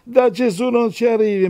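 high, put-on impostor voice; mocking impression of a fraud preacher; mock religious swindler. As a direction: mimic a self-important go-between up high. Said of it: "attenzione agli imbroglioni che dicono".

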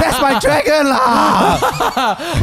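put-on high, slow, laughing Malaysian accent. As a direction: stretch it out in thick Malaysian English. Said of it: "Game of Thrones in a Malaysian accent".

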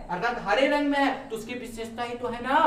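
usual speaking voice

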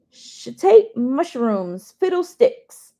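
loud, slow, booming mock uncle voice; Uncle Phil roaring. Narrator voicing Uncle Phil swearing without cussing, booming. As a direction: roar the fake cuss like a gruff uncle. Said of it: "Uncle Phil's voice roars to life".

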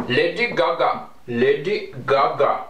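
put-on Indian accent. Shown as a demonstration. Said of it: "with an India people accent".

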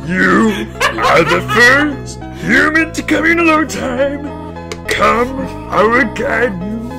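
raspy Yoda meets [name]; Yoda [name] hybrid. Pretend. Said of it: "why did it go to Yoda".

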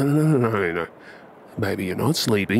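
ordinary talk

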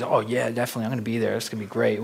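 soft eager friend. Retold or imitated. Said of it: "He goes".

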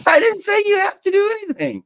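laughing. Real, not acted. Laughing while defending himself.

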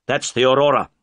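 cry out. Acted. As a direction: call it out across the water. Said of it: "cried Holmes".

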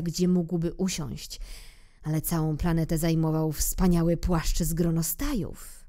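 normal talking voice

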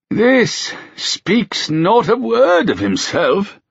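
put-on high, very slow, sighing old king; sad old king. A narrator's reading. Old king reading his son's letter, sadly. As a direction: let it sag with an old man's sorrow. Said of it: "with a sad sigh".

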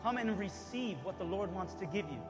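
ordinary talk, nothing marked

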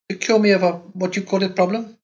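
put-on high, light young wife's voice; Carlotta's girlish voice. A narrator's reading. Narrator voicing the young wife to her husband, high and innocent. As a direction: lift into a childlike wife asking innocently.

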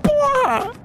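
sudden high yell of shock; shouting. Real, not acted. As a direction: yell out as the character dies. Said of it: "Por isso que eu gritei".

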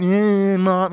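put-on loud sarcastic drawl of a kid; mock the parent, loud and cheeky. A child mocking mom during timeout, loud and sassy. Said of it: "kinda mocking you as they do it".

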